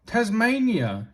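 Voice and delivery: usual speaking voice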